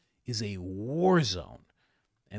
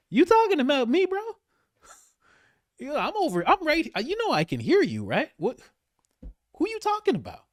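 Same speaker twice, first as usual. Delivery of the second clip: much higher, indignant mock Netanyahu voice; mock Bibi; 5 dB louder than usual. Bibi overhearing Trump's dig, high offended mock voice. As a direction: squeak out an offended who me. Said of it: "Bibi's kinda sitting there like".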